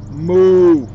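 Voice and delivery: drawn-out loud moo; cow moo. Acted. Reading to kids, voicing the cows: long mooing.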